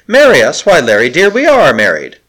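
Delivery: astonished and bewildered; incredulous. Acted. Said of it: "cried the handmaiden incredulously".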